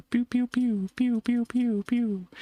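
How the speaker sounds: high, rapid pew pew sounds; mock laser pews. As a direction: fire off cartoon laser noises. Imitating laser shots in game.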